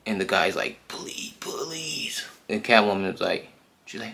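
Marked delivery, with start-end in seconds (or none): 0.9-2.2 high, breathless whispered plea; terrified whisper. Retold or imitated. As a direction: whisper the plea in pure terror.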